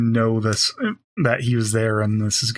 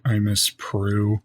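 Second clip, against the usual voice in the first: slow, low deadpan line; low-pitched, emotionless mock confession; 5 semitones lower, slower than usual. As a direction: deliver it dead flat, drawn out. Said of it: "he should have just really deadpan said".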